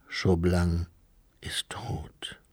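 low, hushed murmur; soft words sinking into a whisper. A narrator's reading. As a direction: murmur it barely audibly, breaking off between words. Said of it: "murmelte Lucé".

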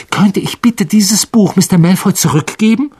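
hastig. A narrator's reading. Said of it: "sagte er hastig". (leicht gehetzte, drängende Bitte)

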